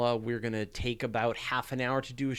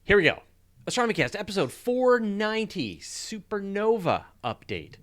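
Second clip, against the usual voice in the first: big, high show-title announcement; announcer voice; 9 semitones higher, 6 dB louder than usual. Acted. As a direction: boom out the episode title like a host.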